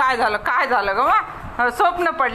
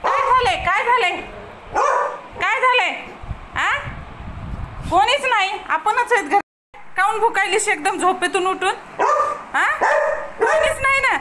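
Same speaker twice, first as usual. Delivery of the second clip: higher, sing-song coaxing to a pet; pet talk; 7 semitones higher than usual. Real, not acted. Owner asks her dog, woken up barking, what happened, in high pet talk.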